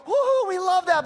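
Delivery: high, loud whooping cheer; a congregation cheering over the verse, excited whoop; mock cheer. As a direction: cheer like a congregation hearing good news. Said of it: "And we go".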